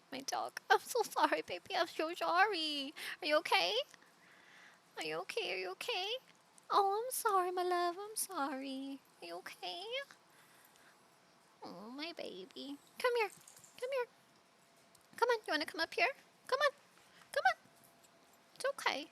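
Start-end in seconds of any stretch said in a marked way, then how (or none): 0.7-7.9 cooing apology. Real, not acted. Owner comforts the dog she scared, high cooing apology. 8.0-12.8 very soft, soothing pet talk; real hushed cooing over a pet; tender apology. Real, not acted. Owner checks the scared dog is okay, softly tender. Comforting her startled dog. 13.0-19.1 gentle coaxing. Real, not acted. Owner invites scared dog to come up, gently coaxing.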